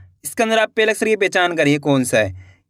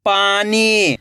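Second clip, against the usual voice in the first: पानी joined as one word with long ई; blended word; much slower than usual. Shown as a demonstration. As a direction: read पानी whole, falling at the end. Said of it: "खींच के पढ़ना है".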